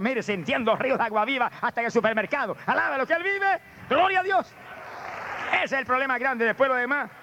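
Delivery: climbing to a high-pitched exclamation; full-force preaching. Acted. Preacher rousing the congregation to live in the Spirit, full-force preaching. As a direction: build to a high shout of praise.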